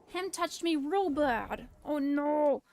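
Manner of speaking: put-on slow high baby talk; babyish whine. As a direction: sulk like a hurt child. Baby-talk complaint after hit.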